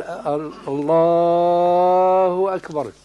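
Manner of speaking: slow, stretched الله أكبر with long vowels; الله أكبر stretched slowly; drawn-out takbir. Shown as a demonstration. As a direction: drag out الله أكبر as the wrong example. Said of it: "فلا يمد، لا يقال".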